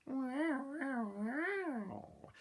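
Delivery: high, mewing wow; cat's meow. Acted. Cat on the wall, high mewing. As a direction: mew like a cat. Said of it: "said the cat".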